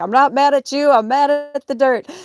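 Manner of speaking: loud, high, hammed-up hysterics; Mommy Dearest. Ranting at her cat in mock maternal fury. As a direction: screech it like a melodramatic movie mother. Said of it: "Mommy dearest".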